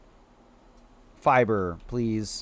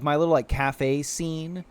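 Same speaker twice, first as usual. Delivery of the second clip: ordinary talk, nothing marked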